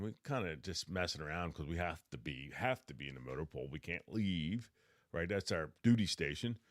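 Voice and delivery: ordinary delivery, nothing marked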